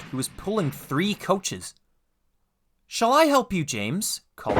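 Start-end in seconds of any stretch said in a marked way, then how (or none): 2.9-4.2 kindly engine. Acted. Edward offers help.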